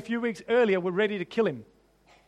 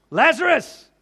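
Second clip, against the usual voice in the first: calling Lazarus, louder and higher; 5 semitones higher, 7 dB louder than usual. A narrator's reading. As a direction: call him out of the tomb. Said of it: "in a loud voice".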